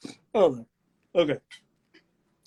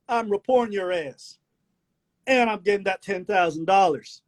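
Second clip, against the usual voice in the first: put-on Southern country accent; 7 semitones higher than usual. Self-styled man of God scolding, Southern country accent. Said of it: "what it is about that accent".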